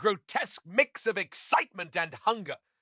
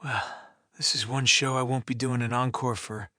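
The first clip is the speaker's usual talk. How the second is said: low, mildly winded remark; understated breathlessness; out of breath; 6 semitones lower than usual. A narrator's reading. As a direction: sigh it out as if winded. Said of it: "out of breath".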